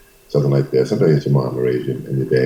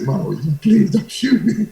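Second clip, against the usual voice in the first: hammed-up frightened boy; begging for his life. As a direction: whimper it like a scared teen facing a gun. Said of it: "I said".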